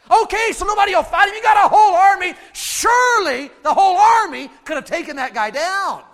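high, loud incredulous protest; exasperated. Real, not acted. Preacher arguing Saul's whole army could win, exasperated shouting. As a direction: holler it in disbelief.

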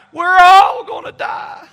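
loud, high, slow wail; imitated panicked cry. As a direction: wail it out like a terrified little girl. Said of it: "Then she'd cry".

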